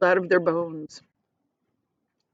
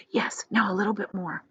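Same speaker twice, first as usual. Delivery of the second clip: softer unvoiced encouragement; whispered; 6 dB quieter than usual. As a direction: coax it in a hush. Charlie whispering.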